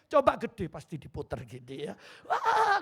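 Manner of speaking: laughing through the punchline; preacher cracking up at his own joke. Real, not acted. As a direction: let the laugh take over. Laughter heard.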